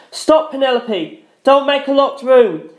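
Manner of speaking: high, slow pleading shout. A narrator's reading. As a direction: shout at her to stop. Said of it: "I shouted".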